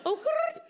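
very high trilled catchphrase. Retold or imitated. Comic likening mother to a rapper, high trilled imitation. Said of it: "[name] nineteen ninety".

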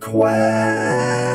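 loud, slow, drawn-out final quack; big loud mock battle cry; hammed-up quack cry. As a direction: belt it out, stretching the quack. Hamming up a quack call.

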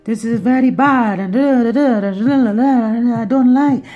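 loud, high imitation of an Italian lady with a broken-English complaint; mock Italian accent. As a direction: ham up an indignant Italian customer, loudly. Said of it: "Italian people could be".

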